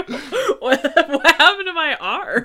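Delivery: high, loud, laughing bewildered yelp; mock bewildered cry. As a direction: squeal it in mock disbelief, laughing. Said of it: "He's like".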